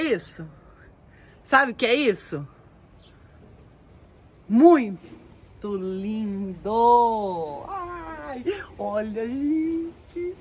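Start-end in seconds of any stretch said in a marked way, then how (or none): 4.5-10.4 enchanted. Real, not acted. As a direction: linger over it in awe. Enchanted by the flowers.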